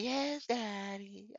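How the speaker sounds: high, slow, put-on submissive voice; mock meek woman. The woman gives in to the host, high and slow mock. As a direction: mimic her giving in, high and slow. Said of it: "That bitch be like".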